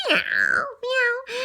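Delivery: high mock meow meow; playful high cat meows. Cats meowing.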